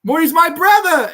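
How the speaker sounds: high, loud, hammed-up reveal; spring the twist on them like a ham; dramatic reveal. He reveals the creature is his brother, hammed-up high. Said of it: "he goes".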